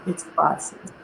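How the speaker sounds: a soft, breathy near-whisper, mildly awed; hushed priceless. Retold or imitated. Founder quoting customers about her walker, near-whisper. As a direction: drop almost to a whisper, as if sharing a secret.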